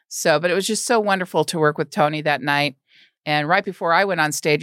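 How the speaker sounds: ordinary talk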